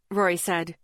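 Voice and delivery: usual speaking voice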